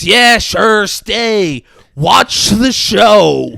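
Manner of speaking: high, loud mock outburst; bitter outburst. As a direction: act out a son lashing out loudly. Retold son's bitter outburst at father.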